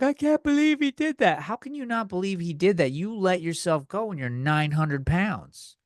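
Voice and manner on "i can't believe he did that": high, whiny disbelief; mock outraged friend. Scandalized people gossiping about a cheater, high and whiny. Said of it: "people are like".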